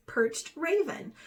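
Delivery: plain reading voice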